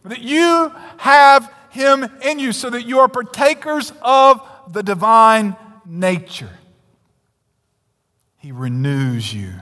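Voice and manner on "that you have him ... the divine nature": loud, high, ringing sermon delivery; impassioned pulpit voice, slow and soaring; full-force preaching. Acted. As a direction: preach it out to the back pews.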